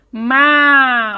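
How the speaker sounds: "ma" with the vowel held long; som da sílaba MA. Shown as a demonstration. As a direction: stretch out "ma" to show the M-A sound. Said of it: "Olha lá o som". ("ma" com a vogal bem alongada)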